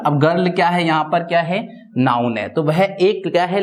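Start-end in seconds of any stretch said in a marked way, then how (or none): none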